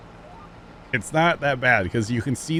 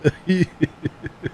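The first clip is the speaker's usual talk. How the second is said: high giggle; amused high-pitched laughing; 5 semitones higher than usual. Real, not acted. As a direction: giggle at your own silly bit. Laughing at own accent bit.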